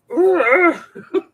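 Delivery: a high, loud burst of real laughter. Real, not acted. Wife cracking up over the story, loud laugh. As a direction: let a big laugh out. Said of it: "I was laughing so hard".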